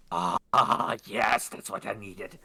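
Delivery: low, satisfied goblin sigh; relieved goblin woman. Acted. Goblin woman alone with her package, low and satisfied. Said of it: "the smacking of lips".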